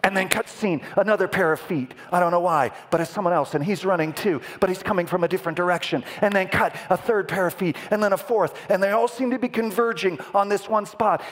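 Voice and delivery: high-pitched, driving scene description; urgent trailer narration. Acted. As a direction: rattle off each cut with mounting urgency. Excited trailer narration of converging runners.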